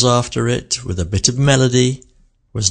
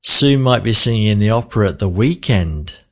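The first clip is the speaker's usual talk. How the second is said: "weekend" slowed with more melody; stress on weekend. Shown as a demonstration. Teacher slowing down on "weekend". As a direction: stretch "weekend" and sing it a little. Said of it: "slow down for it and give it more melody".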